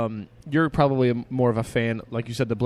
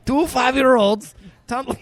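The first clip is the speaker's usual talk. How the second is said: put-on Russian accent; 11 semitones higher, 5 dB louder than usual. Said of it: "then do it like that".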